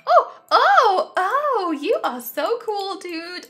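high, loud rising cries of delight; thrilled surprise. Real, not acted. Vlogger finds a cap on a toddler, crying out in delight. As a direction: exclaim as if bowled over.

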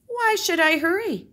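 high, loud, cocky question; a smug rodent sure he'll win; lazy boast. Acted. Paca boasting to himself.

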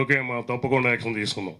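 politician answering at close range; lightly altered voice of a leader replying; Chandrababu Naidu. Acted. Leader replying to press nearby, light impression. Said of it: "Chandrababu గారు దగ్గరగా ఉన్నప్పుడు".

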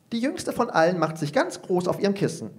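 ordinary delivery, nothing marked

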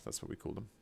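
unvoiced whisper to the chat; whispered aside. Real, not acted. Streamer confides to chat about agents, whispered. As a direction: drop to a secret whisper.

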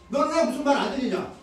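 taunting boss. Retold or imitated. Acted quarrel, boss taunting.

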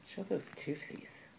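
whispered cooing to the pet. Real, not acted. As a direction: coax it out in a whisper. Coaxing pet to show teeth.

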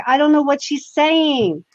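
high-pitched insistent bafflement; imitated helpless protest; retold exasperated protest. Retold protest to accusing management.

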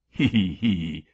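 loud, stagey "he he he" cackle; mocking laugh. A narrator's reading. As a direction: jeer with a put-on cackle. Angel laughs mockingly at the threat.

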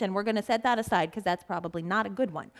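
usual speaking voice

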